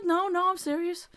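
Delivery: high-pitched retold protest; insistent. Friend reassures her she isn't lying, insistent.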